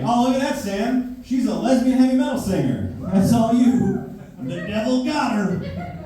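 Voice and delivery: put-on high, slow churchy old lady; high mocking grandma, drawn out slow. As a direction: sneer it like a pious grandma.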